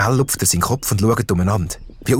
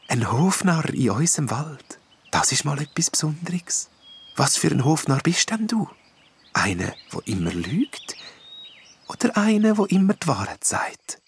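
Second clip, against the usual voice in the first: soft, breathy whispered fairy voice; gentle wood nymph; 6 semitones higher, 4 dB quieter than usual. A narrator's reading. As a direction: soothe the jester kindly. Nymph questions the jester.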